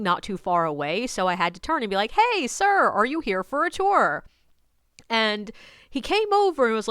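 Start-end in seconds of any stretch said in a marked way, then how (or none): 2.1-4.2 high, bright hail to a stranger; calling out. Retold or imitated. Tour guide hailing a man standing nearby, calling out. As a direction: call out politely, pitched up.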